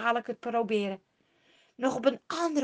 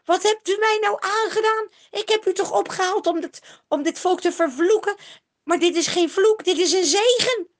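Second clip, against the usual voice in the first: high, loud, wailing complaint; a desperate king whining in dismay; 9 semitones higher, 9 dB louder than usual. A narrator's reading. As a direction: lament that the curse became a blessing. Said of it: "jammert hij".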